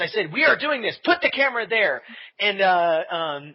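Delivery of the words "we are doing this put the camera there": higher, emphatic overruling; raised-voice mock command; insistent retold order. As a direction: shut down the objection and give the order. Retold firm overruling of friend.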